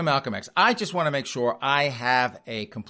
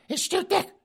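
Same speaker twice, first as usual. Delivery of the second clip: put-on high squeaky old lady griping; falsetto wife complaining. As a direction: whine it in a granny falsetto. Wife voiced arguing.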